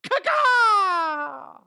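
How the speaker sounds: high loud squawked caw-caw; owl call. Pretend. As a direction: shriek it like a bird. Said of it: "Fucking nailed it again".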